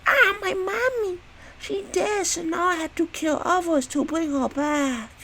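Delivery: put-on very high, slow childlike voice; mock child voice. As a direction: squeak it out like a sulky little kid. Possessed daughter mourns her mother.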